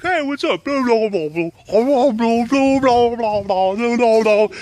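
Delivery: put-on high silly voice for the boys; goofy dog voice. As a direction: play the clueless dogs babbling. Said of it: "just like".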